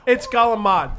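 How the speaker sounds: "calamari" said as gah-la-MAHD; mock Italian-American pronunciation. Podcaster corrects friends on the food word, mock Italian-American pronunciation. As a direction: end "calamari" on a hard MAHD, no final vowel.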